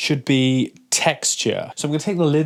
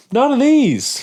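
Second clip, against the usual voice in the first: emphatic; 10 semitones higher, 4 dB louder than usual. Real, not acted. Verdict on sucralose syrups.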